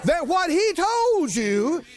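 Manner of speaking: high, slow, shouted declarations; rousing sermon climax; preaching at full force. Real, not acted. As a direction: build the congregation up with fire. Preaching build.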